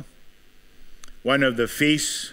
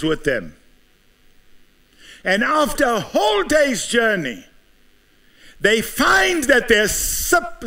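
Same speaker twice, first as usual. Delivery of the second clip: preaching at full force; 6 semitones higher than usual. Acted. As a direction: drive the story home from the pulpit. Preacher builds the story.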